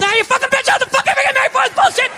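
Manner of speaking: sudden high mock yell, fast; snapping dad. Dad at the dinner table snapping at the mother, sudden yell. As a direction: explode out of nowhere over the salt.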